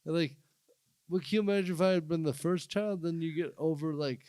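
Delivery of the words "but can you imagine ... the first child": ordinary delivery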